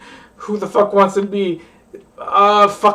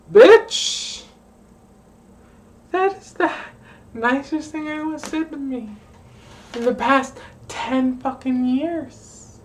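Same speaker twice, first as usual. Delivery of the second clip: much higher, squealing thanks fading softer; mock gushing reply; 6 semitones higher, 3 dB quieter than usual. Replying to the cashier's Mother's Day wish, squealing gratitude. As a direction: gush like a stunned, delighted friend. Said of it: "And I was like".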